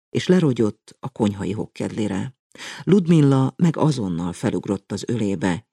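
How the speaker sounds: ordinary delivery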